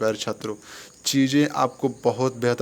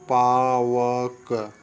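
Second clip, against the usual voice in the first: Pavak stretched very slowly, each syllable held; Pavak spelled out slowly with a falling end; drawn-out demo; 4 dB louder, much slower than usual. Shown as a demonstration. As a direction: draw out Pavak so students hear the sounds. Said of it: "तो पावक देखिए".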